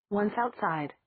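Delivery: ordinary delivery, nothing marked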